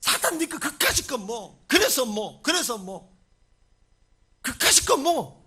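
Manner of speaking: loud, defiant brush-off; scornful dismissal. Shown as a demonstration. Believer showing how to ignore Satan, scornful dismissal. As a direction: throw each question out with contempt. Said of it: "사탄을 완전히 무시해버려라".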